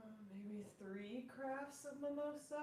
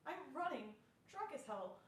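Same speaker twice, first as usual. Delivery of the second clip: ordinary delivery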